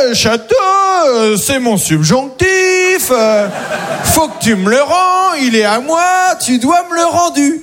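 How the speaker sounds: getting annoyed. Retold or imitated. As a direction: whine it like an angry drunk reclaiming his toy. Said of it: "Kevin commence à s'énerver".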